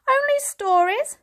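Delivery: scoffing surprise; incredulous. Acted. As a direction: toss the words back in disbelief. Grandfather incredulous.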